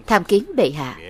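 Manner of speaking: usual speaking voice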